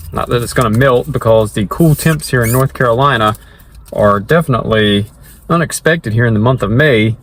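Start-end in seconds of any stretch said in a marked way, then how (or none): none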